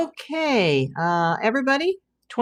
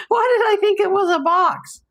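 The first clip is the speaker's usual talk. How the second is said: much higher, real laugh through the words; laughing at herself; 13 semitones higher, 4 dB louder than usual. Real, not acted. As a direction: laugh off a silly mistake. Laughing at her own mistake.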